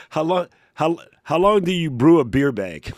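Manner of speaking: usual speaking voice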